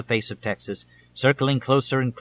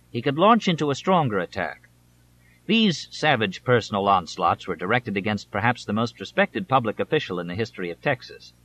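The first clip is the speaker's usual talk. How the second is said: usual speaking voice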